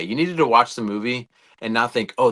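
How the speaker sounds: ordinary delivery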